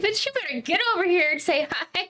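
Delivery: high-pitched pet-owner coaxing; indignant pet call, acted out; mock scolding. Calling a reluctant pet over.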